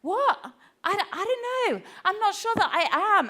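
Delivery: high-pitched, protesting second thoughts; incredulous doubt. Retold or imitated. One part of herself arguing back, high incredulous doubt. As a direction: squeak out disbelief at being blamed. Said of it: "another part of me over here".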